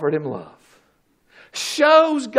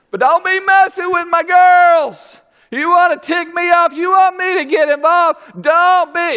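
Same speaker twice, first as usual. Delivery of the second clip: louder, higher mock threat; protective father warning; 7 semitones higher, 3 dB louder than usual. Father warning anyone messing with his girls, mock threat. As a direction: back off, I'm warning you.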